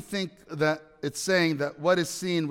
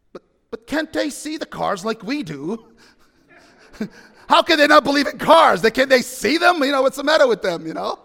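mock-indignant little boy, louder and higher; baffled child; 7 semitones higher, 7 dB louder than usual. Four-year-old Lukey protesting to the family, hammed-up disbelief. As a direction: play the kid who can't understand it. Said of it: "Lukey's brain was moving".

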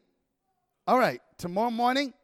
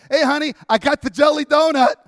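higher, louder call through the dark house; proud announcement; 7 semitones higher, 10 dB louder than usual. Retold or imitated. Husband announcing the donut to his sleeping wife, loud and proud. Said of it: "I actually announce".

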